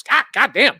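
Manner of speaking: high, loud cry of pain; mock pained outburst. Imitating a shot opponent, high loud mock yelp. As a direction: yelp it like you just got hit. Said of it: "he goes".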